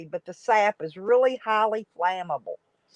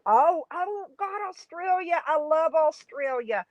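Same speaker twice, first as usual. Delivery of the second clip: real delight; 7 semitones higher than usual. Real, not acted. Delight at a viewer from Australia.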